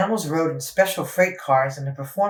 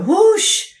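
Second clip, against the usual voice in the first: whoosh; 8 dB louder than usual. A narrator's reading. Elephant squirts water.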